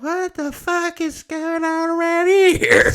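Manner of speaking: high, drawn-out panicked yell; really loud. Retold or imitated. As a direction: yell it way up high, stretching the words. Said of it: "and I said it, like, really loud".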